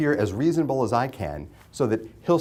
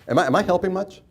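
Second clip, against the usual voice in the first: usual speaking voice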